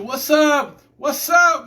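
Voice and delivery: high, loud, happy hello to a newcomer; excited greeting. Real, not acted. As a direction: call out a big welcome to your sister. Greets sister joining live.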